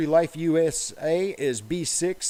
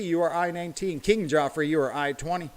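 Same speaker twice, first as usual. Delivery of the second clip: ordinary delivery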